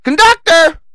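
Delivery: very high, loud mock squeal; piercingly high mocking cry for help; voice turned whiny wimp. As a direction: mimic a tough guy turning into a shrieking coward. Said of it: "Then his voice changed".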